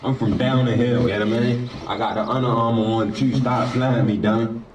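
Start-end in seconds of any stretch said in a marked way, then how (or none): none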